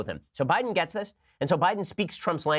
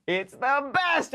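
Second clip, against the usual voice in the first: high, slow laughing words; laughing delight; 10 semitones higher, much slower than usual. Real, not acted. Host cracking up at the tweet, laughing. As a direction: laugh it out, tickled.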